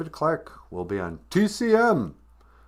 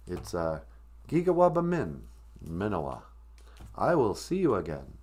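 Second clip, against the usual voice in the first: normal talking voice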